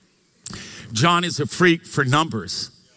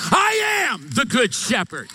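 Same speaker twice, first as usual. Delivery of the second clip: high, ringing preacher's cry; shouted proclamation; 6 semitones higher than usual. Acted. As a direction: cry it out as the climax of a sermon. Climax of preacher's I am list.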